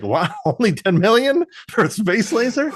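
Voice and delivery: high, rising incredulous question; amused, squeaky incredulity; mock disbelief. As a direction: scoff in amazement at the figure. Mock disbelief at low price.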